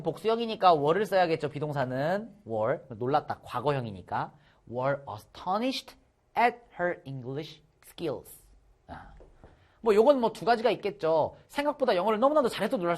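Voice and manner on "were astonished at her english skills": very slow, careful read-aloud; slow model reading. Shown as a demonstration. Teacher repeating the phrase slowly for students. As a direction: spell out each word slowly.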